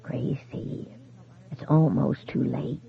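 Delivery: breathy whisper down a phone line, slow; faint ghostly voice. A narrator's reading. Dead husband on the phone urging his wife, faint and slow. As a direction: sound far away and barely there. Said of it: "The voice was faint but intelligible".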